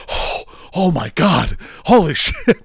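breathless gasp then a laughing, high holy shit; post-sex gasping. Retold or imitated. Retelling the movie couple after sex, gasping awe. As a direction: play the spent lover, gasping in awe. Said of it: "they're like".

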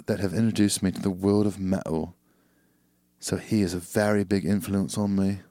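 put-on relaxed, soothing British accent. Reading a listener's email to co-host, soothing British accent. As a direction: read it out in an easy British accent. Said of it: "I read this better in a British accent".

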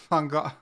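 Van Gogh with Gogh cut short, then a cough; swallowed Van Gogh. Shown as a demonstration. Co-host trying the Dutch name, swallowed halfway. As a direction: choke off the end of Van Gogh. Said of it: "swallowed halfway through that one".

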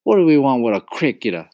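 high, fast, loud dismissal; scornful. A narrator's reading. Mama rejects the cricket, scornful.

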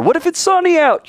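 high-pitched excited what-if; questioning student. Acted. Student pressing the teacher, high questioning voice. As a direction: pipe up high with another objection. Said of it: "Kinda like yesterday, remember?".

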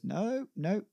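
high, drawn-out mock refusal; adamant refusal. Shakespeare refuses to talk about it with John, high and adamant.

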